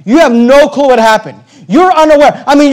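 ordinary delivery

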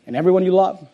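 usual speaking voice

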